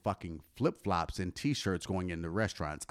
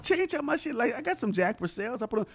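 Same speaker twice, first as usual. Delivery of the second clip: much higher, sheepish self-mocking voice; imitating his younger self. Retelling his own reaction at the bar, high and sheepish. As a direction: concede the point in a squeaky mock voice. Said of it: "but it was like".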